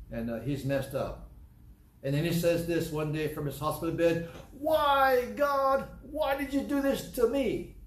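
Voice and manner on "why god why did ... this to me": angry cry to God. Retold or imitated. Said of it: "He's very mad".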